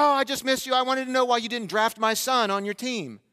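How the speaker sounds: high-pitched mock female voice; imitated woman. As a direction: play the complaining mother, voice lifted. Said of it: "She's like".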